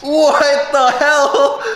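high slow laughing disbelief; shocked laughing outburst. Real, not acted. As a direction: stretch it out in shock. Shocked at five chips.

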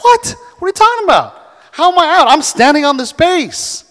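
high, loud mock outrage; disbelieving player. Runner protesting the umpire's call, high mock disbelief. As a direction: protest the call, stunned and indignant. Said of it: "the guy on second base was like".

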